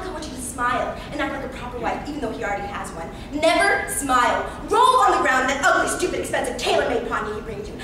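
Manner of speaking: ordinary talk, nothing marked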